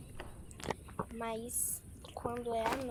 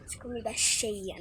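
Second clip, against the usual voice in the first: ordinary talk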